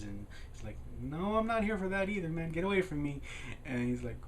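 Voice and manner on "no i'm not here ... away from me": higher, mock-exasperated brush-off; annoyed refusal. Kid fending off the pushy librarian, annoyed refusal. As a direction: push him away with a raised voice.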